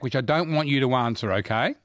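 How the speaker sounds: ordinary talk, nothing marked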